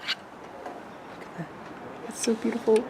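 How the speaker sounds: slow hushed whisper. Real, not acted. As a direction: whisper it in awe. Hushed admiring of the sky.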